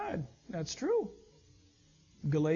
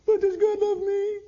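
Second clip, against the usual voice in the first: high, louder pleading question; anxious inner doubt; 10 semitones higher, 6 dB louder than usual. Retold or imitated. Believer asking himself, anxiously pleading. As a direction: blurt it out like a doubting child.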